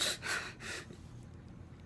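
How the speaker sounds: a long, breathy imitated sigh of giving up; retold defeated sigh. Imitating the crying kid's sigh after losing the argument, a long defeated exhale. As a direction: let out a heavy mock sigh, no voice. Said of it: "And he was like".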